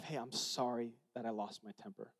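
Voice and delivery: quiet, gentle sorry; soft apology. Retold or imitated. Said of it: "in front of my wife".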